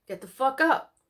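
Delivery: loud, harsh wake-up command; a father yelling at his son; barked order. A narrator's reading. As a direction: order him out of bed. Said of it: "ordered Ralph".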